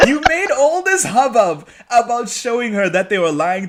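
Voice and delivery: high-pitched rant through laughter; laughing exasperation. Real, not acted. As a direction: complain while cracking up.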